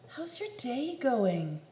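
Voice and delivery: usual speaking voice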